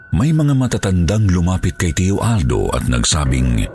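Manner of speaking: normal talking voice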